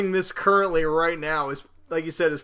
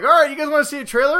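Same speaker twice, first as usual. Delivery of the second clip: excited presenter; 8 semitones higher, 6 dB louder, faster than usual. Retold or imitated. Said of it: "they're like".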